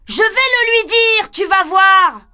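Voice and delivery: put-on high, furious child yelling; angry shout. Girl shouting angrily at the eavesdropping wolf. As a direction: scold him at full voice. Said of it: "Je crie".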